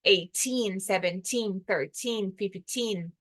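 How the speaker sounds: put-on the teen ending clearly stressed in "Eighteen, seventeen, thirteen, fifteen"; strong teen in Eighteen. Shown as a demonstration. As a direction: hit the final -teen each time. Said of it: "aí o teen fica forte".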